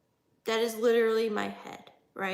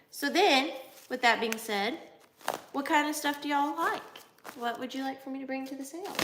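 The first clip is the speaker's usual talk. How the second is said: ordinary delivery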